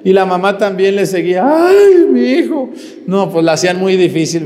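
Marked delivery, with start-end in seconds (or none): 1.4-2.7 high, loud wail; mock wailing mother. Mother crying as her son stays at seminary, mock wailing. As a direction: cry out like a grieving mother.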